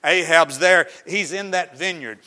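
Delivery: usual speaking voice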